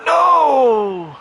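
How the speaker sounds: huge, high cry of dismay; anguished shout. Real, not acted. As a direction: scream as the keeper saves it. Big chance saved.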